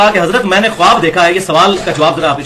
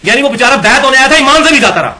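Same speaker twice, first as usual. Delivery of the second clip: indignant outcry; 7 semitones higher, 5 dB louder than usual. Real, not acted. Lamenting the poor disciple.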